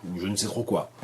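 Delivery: ordinary talk, nothing marked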